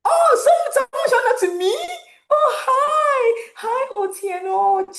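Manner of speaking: high, tearful voice breaking with happy surprise; overwhelmed, laughing through tears; crying with joy. Real, not acted. Host greeting a friend who showed up, crying with joy. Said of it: "Jesus, I'm crying".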